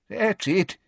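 put-on high, wavering old man; quavery old voice. A narrator's reading. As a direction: encourage in an elderly quaver. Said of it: "in his quavery old voice".

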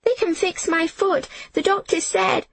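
put-on high, eager girl's plea; excited child's insistence; hopeful. A narrator's reading. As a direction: blurt it out high with sudden hope. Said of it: "My heart leaped".

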